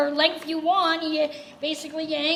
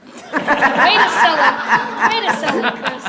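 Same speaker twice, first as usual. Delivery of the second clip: laughing; 13 dB louder than usual. Real, not acted. Teasing Chris's flat plug.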